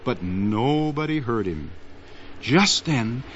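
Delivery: plain reading voice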